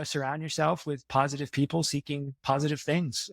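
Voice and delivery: normal talking voice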